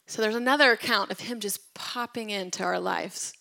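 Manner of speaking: ordinary delivery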